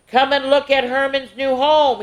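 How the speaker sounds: high, excited call. Acted. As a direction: call them over. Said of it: "he called".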